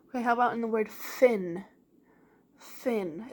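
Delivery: ordinary talk, nothing marked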